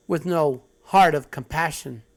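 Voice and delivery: ordinary talk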